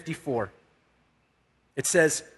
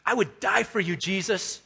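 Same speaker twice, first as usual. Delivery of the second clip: higher-pitched, insistent pledge on DIE; impassioned vow; 3 semitones higher than usual. Retold or imitated. Peter proving his loyalty to Jesus, higher and insistent. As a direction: press the promise with fervour, pitch lifted. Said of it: "to prove his faith and to prove his loyalty".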